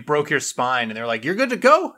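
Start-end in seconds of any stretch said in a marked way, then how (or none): 1.2-1.9 high, loud, breezy all-clear; mock cheerful. Doctor breezily clearing someone with broken spine, mock cheerful. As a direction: sound absurdly upbeat about it.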